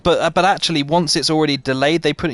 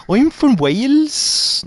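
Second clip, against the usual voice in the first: high, drawn-out attempt at Welsh; awful Welsh accent; 10 semitones higher, much slower than usual. Shown as a demonstration. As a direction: try a Welsh accent and fail. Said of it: "No, that's not Welsh".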